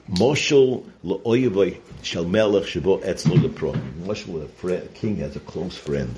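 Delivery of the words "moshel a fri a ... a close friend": ordinary talk, nothing marked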